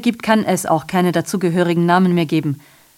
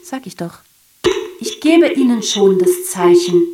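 usual speaking voice

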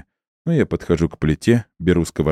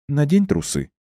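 higher, lightly voiced woman; woman's voice; 9 semitones higher than usual. A narrator's reading. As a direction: play her lightly, a touch higher. Female character voiced by male reader.

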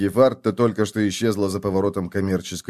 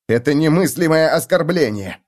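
mock outrage; 5 semitones higher, 4 dB louder than usual. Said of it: "заявил он".

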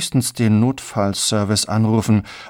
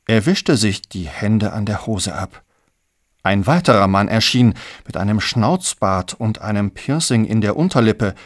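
plain reading voice

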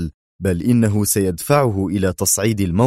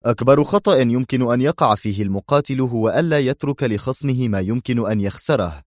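ordinary delivery, nothing marked

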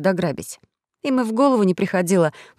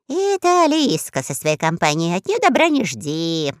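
rat voice. A narrator's reading. Rat continues.

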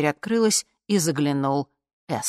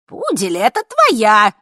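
brisk, high crowd retort; cheeky loud holler from old ladies; perky shouting; 6 semitones higher, 10 dB louder than usual. A narrator's reading. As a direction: shout back boldly. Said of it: "бойко закричали старушки".

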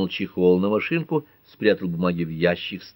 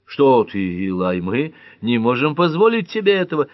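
high, louder flustered protest; agitated, raised-voice dismay; alarmed; 5 semitones higher, 5 dB louder than usual. A narrator's reading. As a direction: object in sudden alarm, pitch climbing. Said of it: "всполошился Джон Ф".

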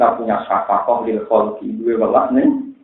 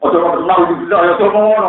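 louder, urgent warning; emphatic plea; 6 semitones higher, 5 dB louder than usual. Real, not acted. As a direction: plead with them not to. Pleading warning, raised voice.

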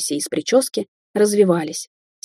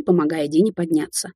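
usual speaking voice

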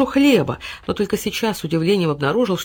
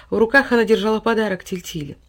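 ordinary delivery

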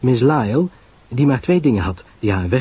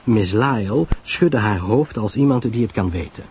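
ordinary delivery

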